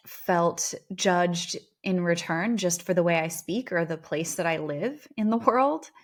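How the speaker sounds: usual speaking voice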